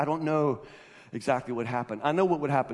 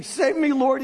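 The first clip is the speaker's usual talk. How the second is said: high, loud cry for rescue; sudden high shout for help; shouted plea; 11 semitones higher, 8 dB louder, slower than usual. A narrator's reading. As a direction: call out like a sinking man. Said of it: "he shouted".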